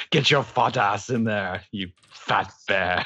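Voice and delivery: slow, hammed-up half-British accent; a bad stab at posh British, slowing right down; mock British accent; attempted British accent. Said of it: "maybe trying to be British".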